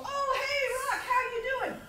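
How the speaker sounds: high bright hello to her brother; cheery greeting. Retold or imitated. Sister answers brother's call, high cheerful hello. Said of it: "and I go".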